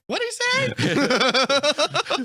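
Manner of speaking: put-on high, drawn-out squeaky kid voice, laughing; a kid straining to hear, words stretched and laughing; mock Spider-Man, confused. Spider-Man far off asks what Cap said, squeaky and slow.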